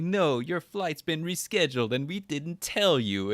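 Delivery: high, sing-song dismissive retelling; mock mean airline staff. Airline staff brushing off a traveler, mock mean. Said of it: "were just being mean to him".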